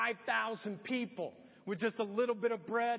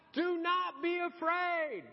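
slow, higher call across the water, drawn out; voice crying out; 6 semitones higher, slower than usual. Retold or imitated. Jesus calls out to frightened disciples, slow and raised. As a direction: call out slowly over the storm. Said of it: "this voice cries out".

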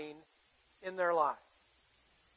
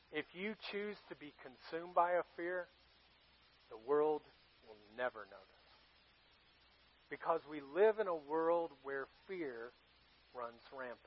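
hushed solemn; 6 semitones lower, 15 dB quieter than usual. Real, not acted. Solemn warning in sermon.

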